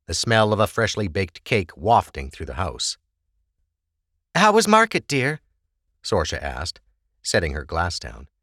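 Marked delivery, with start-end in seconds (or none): 4.3-5.4 high, gentle tipsy mother. A narrator's reading. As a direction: ask warmly, a bit drunk. Mother voiced in story.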